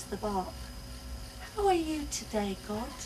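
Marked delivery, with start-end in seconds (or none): 1.6-2.9 put-on child's question. Child to tree.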